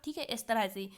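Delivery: normal talking voice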